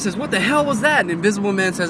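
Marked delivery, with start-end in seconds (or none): 0.2-1.0 high-pitched shocked question; mock-startled outcry; startled Wonder Woman. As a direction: act out sudden bewilderment, pitch jumping up.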